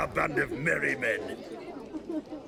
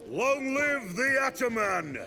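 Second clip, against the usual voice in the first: shouted cheer, pitched up; 7 semitones higher than usual. Acted. Bandit answering the toast, shouting cheer. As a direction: roar the toast back.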